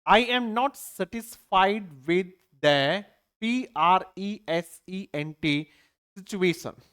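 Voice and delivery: sentence read out very slowly; slow reading aloud. Shown as a demonstration. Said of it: "ये देखिएगा". (उदाहरण वाक्य धीरे-धीरे पढ़ना)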